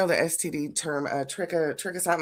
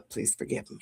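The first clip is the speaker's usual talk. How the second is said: normal talking voice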